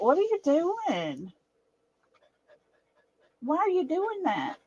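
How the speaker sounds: high, soft cooing to a dog; pet talk. Real, not acted. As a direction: coax a nervous pet gently, voice up high. Cooing at her anxious dog.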